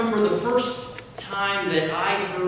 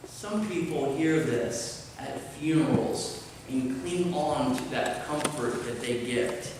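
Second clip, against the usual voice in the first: ordinary talk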